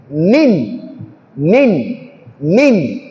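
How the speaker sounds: "Min" with a distinct n, lips and teeth closed; clear nun. Shown as a demonstration. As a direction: say "min" cleanly, no nasal hiding. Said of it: "pronounced clearly".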